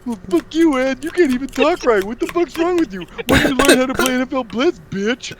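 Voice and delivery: loud, high-pitched mock abuse; hammed-up trash-talking retort; retold trash talk. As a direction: yell insults back at a friend. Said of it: "I would just be like".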